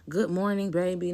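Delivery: high, sugary good-morning tone; sweet mom voice. Retold or imitated. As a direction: coo it like a doting mother. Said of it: "Not a".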